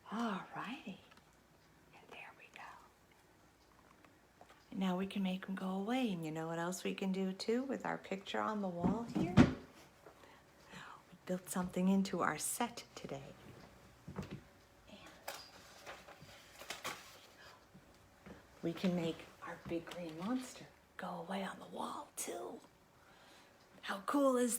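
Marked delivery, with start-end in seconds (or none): 0.1-2.7 soft unvoiced whisper. Reader whispering to the kids as the monster is done. 9.4-22.6 soft unvoiced whisper. Reader whispering to the kids as the monster is done.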